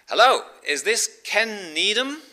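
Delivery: loud, high-pitched Canadian caller; Canadian accent. Acted. Canadian caller rings a Bible teacher at night, loud Canadian accent. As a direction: put on a big Canadian phone voice. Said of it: "this Canadian voice comes back to him".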